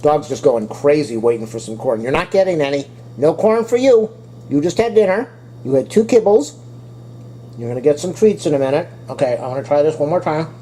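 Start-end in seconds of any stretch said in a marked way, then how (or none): 3.2-6.6 talking to the dog. Real, not acted. Teasing the begging dog.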